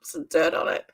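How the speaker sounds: "Some dirt on it" whispered to herself; whispered repeat. Real, not acted. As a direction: whisper the punchline again.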